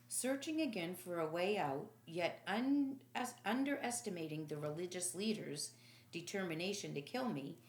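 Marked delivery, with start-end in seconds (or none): none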